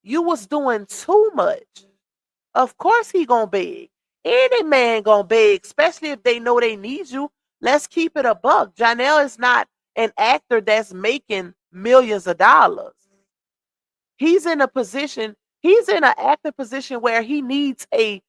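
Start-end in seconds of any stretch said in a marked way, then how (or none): none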